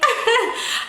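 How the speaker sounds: high embarrassed giggle. Real, not acted. Giggling about the little boy.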